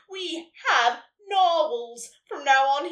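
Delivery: slow, loud proclamation; beaming Santa. A narrator's reading. Santa announcing a new tradition, beaming. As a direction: proclaim it big and proudly. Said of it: "he beamed".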